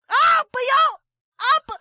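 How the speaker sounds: high, loud fake screams; mock shriek. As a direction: shriek like a startled victim. Acted shrieks as victim.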